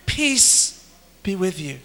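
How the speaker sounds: soft, fading blessing; tender hushed voice of Jesus; gentle peace. Retold or imitated. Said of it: "Jesus says the second time".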